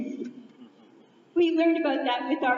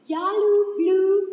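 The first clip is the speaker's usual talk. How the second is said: louder, slow drawn-out call of the name; camp cheer; 4 semitones higher, 7 dB louder, slower than usual. Acted. Speaker rallies the audience with the camp name, called out slowly. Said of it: "camp name called out".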